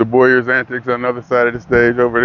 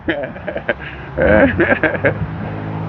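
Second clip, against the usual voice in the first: laughing; 6 semitones higher, 3 dB quieter than usual. Real, not acted. Laughing after the yelp.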